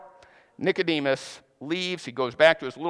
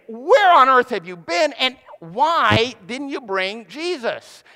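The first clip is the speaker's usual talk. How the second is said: loud, high, indignant demand; angry demand; 7 semitones higher, 6 dB louder, slower than usual. Retold or imitated. Pharisee leaders scolding their temple guards, loud and indignant. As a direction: bark at the guards for coming back empty-handed. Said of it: "And they look at him and say".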